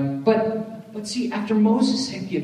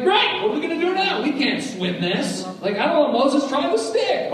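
panicky Israelites; 10 semitones higher, 3 dB louder than usual. Retold or imitated. Israelites panic at Red Sea.

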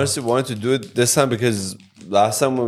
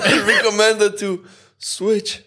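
laughing; 9 semitones higher, slower than usual. Real, not acted. Laughing, mouth on fire.

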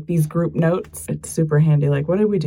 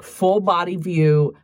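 normal talking voice

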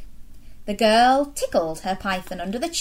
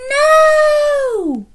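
much higher, loud wailing no; children shouting no; 9 dB louder than usual. Acted. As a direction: cry out in dismay as a class. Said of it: "All the girls and boys shouted".

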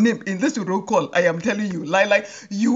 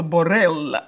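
usual speaking voice

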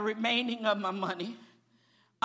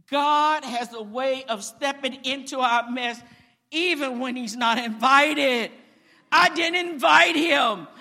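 louder rising preacher's build; preaching at full force; 7 dB louder than usual. Acted. Preacher telling the church God steps into our mess, full-force preaching. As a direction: lift the congregation, building and ringing. Preacher drives home the point.

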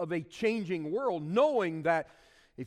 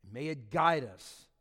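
prayer; 3 semitones lower, 4 dB quieter than usual. Acted. Pastor praying over the congregation, soft and reverent. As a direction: pray it gently over the room.

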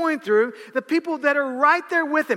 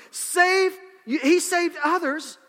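scoffing; 7 semitones higher, 3 dB louder, much slower than usual. Acted. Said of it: "they scoffed".

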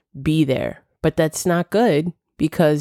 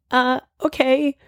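higher, slow, hesitant mock self; awkward okay; 9 semitones higher than usual. Retelling her awkward reply to the stranger, higher and hesitant. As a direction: drag out a sheepish okay. Retold quarrel over apologizing.

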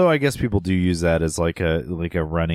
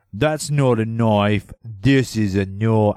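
put-on slow, drawn-out Australian accent; a lightly voiced Australian tough guy; Aussie Dundee impression; slower than usual. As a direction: do Mick Dundee's Australian line, slowly. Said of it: "I sound like I'm cognate".

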